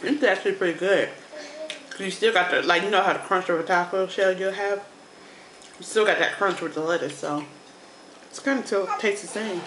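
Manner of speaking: talking between bites; chewing while speaking; mouth full, chewing. Real, not acted. Seen 0: Eats lettuce wrap throughout; bites again near 7.5s while speaking.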